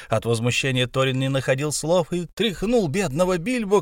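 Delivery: ordinary delivery, nothing marked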